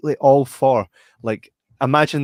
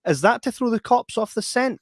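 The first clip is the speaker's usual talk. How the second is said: higher, lighter disguised voice; pitched-up take on a film character; imitating a changed voice; 9 semitones higher than usual. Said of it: "his voice starts to change towards the end".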